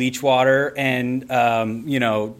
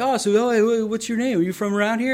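eager chatty server; 9 semitones higher, faster than usual. Retold or imitated. Fishing for their names.

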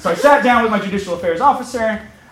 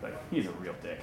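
muttered secret, much softer; hushed aside; 10 semitones lower, 21 dB quieter, faster than usual. Retold or imitated. Officer privately badmouths the other official to the student, under his breath. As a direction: slip it in under your breath.

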